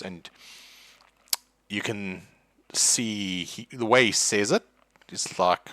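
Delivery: ordinary delivery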